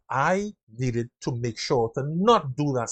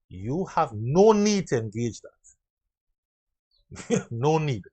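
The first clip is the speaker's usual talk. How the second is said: normal talking voice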